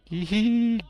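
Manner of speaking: squeaky high-pitched giggle; high creature giggle. Pretend.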